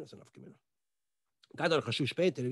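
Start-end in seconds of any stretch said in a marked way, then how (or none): 0.0-0.6 the question repeated in a bare whisper. Retold or imitated. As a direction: breathe it out with no voice. Geflüsterte Wiederholung der Frage. (geflüstert, ganz ohne Stimme)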